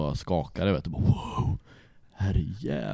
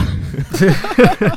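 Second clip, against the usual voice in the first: loud high cackle; high loud laughing fit; burst of laughter; 10 semitones higher, 12 dB louder than usual. Real, not acted. Laughing at own misfortune.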